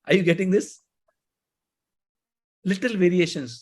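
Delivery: ordinary delivery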